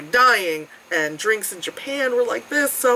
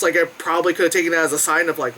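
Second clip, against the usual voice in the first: normal talking voice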